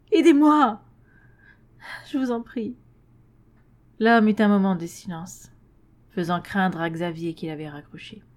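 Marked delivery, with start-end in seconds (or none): 0.1-2.8 high, loud cry for help; desperate plea. A narrator's reading. Xavier smeekt wanhopig.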